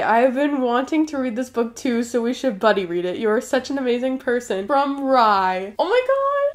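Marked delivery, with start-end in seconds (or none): 5.8-6.5 high squeal of surprise; surprised squeal. Real, not acted. Opening birthday gift.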